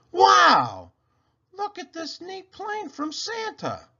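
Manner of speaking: put-on high, loud delighted kid voice; a kid thrilled by his present; excited boy. A narrator's reading. Boy opening his present, high excited kid voice.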